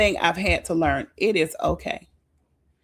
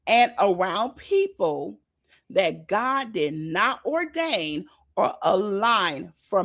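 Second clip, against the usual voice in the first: slow reread; much slower than usual. Shown as a demonstration. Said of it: "I'm gonna read that again".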